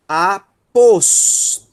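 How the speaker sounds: Aposto drawn out very slowly, syllable by syllable; slow syllable drill. Shown as a demonstration. Teacher sounding out Aposto slowly for students. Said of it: "Aí nós temos".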